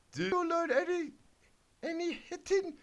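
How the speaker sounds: put-on very high, piping girly voice; squeaky Miriam the wizard. Reading the kid's story, Miriam tells the spiders in a very high squeak. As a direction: squeak it like a little pony wizard. Miriam asks, narration slips.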